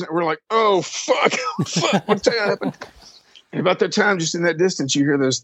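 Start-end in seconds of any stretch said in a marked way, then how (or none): 0.5-2.8 high, alarmed exclamation; mock panic. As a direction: imitate friends fearing a crash. Band panics at distant tire squeal.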